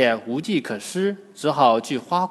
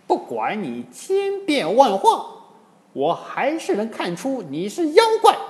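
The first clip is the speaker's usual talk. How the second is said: high-pitched furious monkey hero; indignant high-voiced accusation; angry shout; 8 semitones higher than usual. A narrator's reading. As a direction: call out the impostor in a high, forceful voice. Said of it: "喝道".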